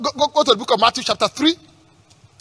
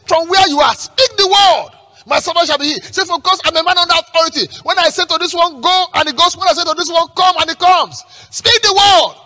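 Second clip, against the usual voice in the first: high, loud, driving sermon delivery; high-pitched pulpit fervour; preach; 9 semitones higher, 5 dB louder than usual. Acted. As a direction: fire out the scripture, punching each command. Preaches at full force.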